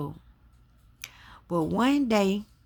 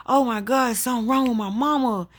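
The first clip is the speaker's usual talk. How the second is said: higher, louder retold alarm; mild retold panic, pitched up; 6 semitones higher, 5 dB louder, faster than usual. Her alarmed reaction on hearing screams.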